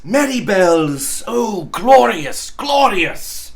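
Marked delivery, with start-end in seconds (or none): none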